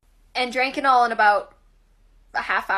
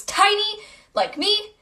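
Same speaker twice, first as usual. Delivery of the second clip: cute high voice; 9 semitones higher, slower than usual. Real, not acted. High cute self-joke.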